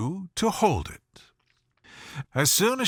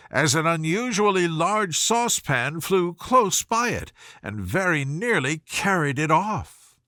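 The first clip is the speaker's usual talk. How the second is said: ordinary delivery, nothing marked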